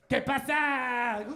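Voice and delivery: loud, slow, drawn-out Spanish greeting. Retold or imitated. New coworker greeting the office, loud drawn-out Spanish. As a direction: stretch out the hello in a Spanish accent.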